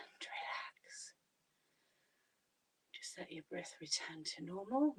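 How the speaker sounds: calm them down in a whisper. Shown as a demonstration. Calming down after breath hold.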